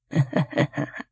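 a soft breathy creature laugh; low unvoiced chuckle. A narrator's reading. Thing under the bed mocks her threat with a quiet laugh. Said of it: "made it laugh".

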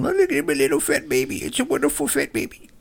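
high, cooing mock baby talk. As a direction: coo it like a doting parent. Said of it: "little fat baby that you coddle".

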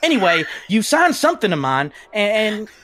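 put-on southern accent. [name] greeting Ref as a fan, southern accent. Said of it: "I just really like your southern accent".

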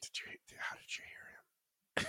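barely audible slow whisper; notorious whisperer. Retold or imitated. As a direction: whisper it so nobody can quite hear. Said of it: "a notorious whisperer".

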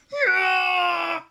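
loud high Wookiee howl; Wookiee roar. Shown as a demonstration. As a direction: roar like Chewbacca.

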